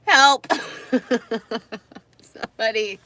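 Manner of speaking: high, loud joking call for help; mock cry for help. Weary vlogger jokingly calling for rescue, loud. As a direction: call out as if stranded.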